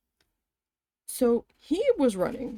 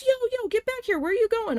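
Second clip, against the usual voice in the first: much higher, louder put-on voice yelling after someone; angry manager stopping coworker leaving; mocking angry boss; 14 semitones higher, 3 dB louder, faster than usual. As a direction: imitate a boss calling her back in a cartoonish pitch. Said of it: "And he was pissed".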